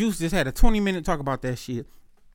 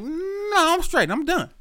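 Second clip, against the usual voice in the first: fed-up refusal; 11 semitones higher, slower than usual. Retold or imitated.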